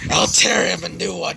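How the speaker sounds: mildly angry threat; a touch of menace, understated; angered. A narrator's reading. Boy warning the sister about her brother, mild anger. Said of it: "with an angered tone".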